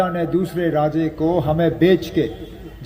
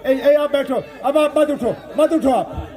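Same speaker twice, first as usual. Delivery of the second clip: high-pitched real command to sit; calling out to the crowd; 9 semitones higher than usual. Real, not acted. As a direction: call out sharply high above your speech voice. Telling audience members to sit down.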